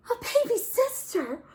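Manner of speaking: high, loud astonished cry; shocked surprise. Acted. Bear astonished at the new baby sister. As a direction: gasp it out in disbelief.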